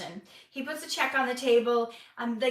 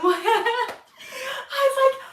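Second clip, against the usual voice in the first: high, slow laugh through the words; real laughter breaking in; 12 semitones higher, 8 dB louder, much slower than usual. Real, not acted. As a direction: laugh helplessly as you talk. Laughing at own story.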